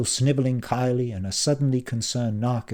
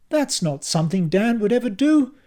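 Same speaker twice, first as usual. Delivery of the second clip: fatherly defence; 13 semitones higher, 6 dB louder than usual. A narrator's reading. Dad defending son.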